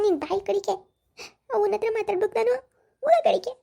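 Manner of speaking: crying daughter. Acted. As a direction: wail it out like a hurt young woman. Daughter arrives crying to father. (ಅಳುತ್ತಾ ದೂರುವ ಮಗಳು)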